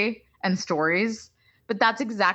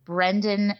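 ordinary talk

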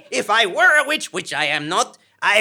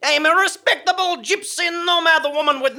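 indignant outrage; 7 semitones higher than usual. Acted. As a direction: bristle with outrage. Offended woman rebuts witch accusation.

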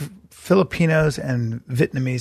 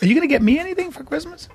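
mock pleading. Begging for a Christmas gift.

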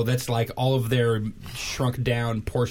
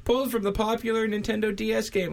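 high, showy stage magician patter; 9 semitones higher than usual. Acted. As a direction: announce it like a vaudeville magician. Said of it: "that was a good magician guy voice".

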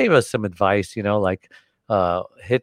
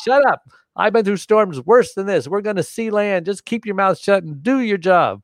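stern order; 10 semitones higher than usual. Retold or imitated. Captain silencing panicking crewman.

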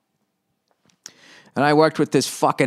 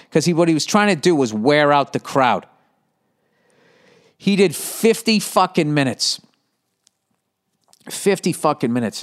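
normal talking voice

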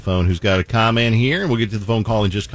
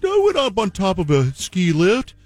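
higher, flustered made-up excuse; higher mock voice, scrambling for an excuse; mock liar voice; 11 semitones higher, slower than usual. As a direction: squeak out the lame excuse. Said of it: "So I was like".

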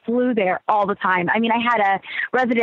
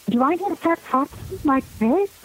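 put-on breathy childlike voice; 6 semitones higher than usual. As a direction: mimic their sweet little whispery talk. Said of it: "childlike whisper voice".

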